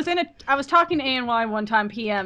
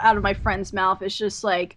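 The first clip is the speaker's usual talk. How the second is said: ordinary talk, nothing marked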